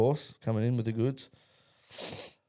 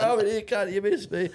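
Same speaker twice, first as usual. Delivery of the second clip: gleeful taunt; 9 semitones higher than usual. Retold or imitated. Teller taunting James from the shower, gleeful. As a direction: crow that the prank missed you. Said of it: "I'm like".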